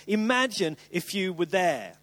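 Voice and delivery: ordinary talk, nothing marked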